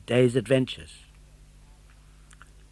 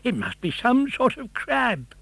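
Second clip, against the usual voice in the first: puzzled fisherman; 11 semitones higher than usual. A narrator's reading. Fisherman puzzling over catch.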